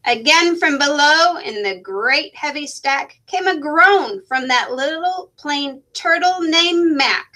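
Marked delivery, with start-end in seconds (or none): none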